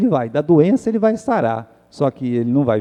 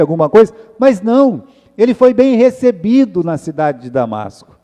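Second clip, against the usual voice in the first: higher, louder preaching build; 5 semitones higher, 3 dB louder than usual. Acted. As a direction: drive the point home to the congregation. Preaching at rising force. (pregação fervorosa, mais aguda e mais forte)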